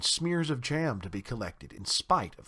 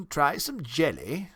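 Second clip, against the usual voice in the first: slow, coaxing offer; drawn-out polite invitation; courteous town mouse host; 3 semitones higher, slower than usual. A narrator's reading. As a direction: offer it slowly in a refined host's voice.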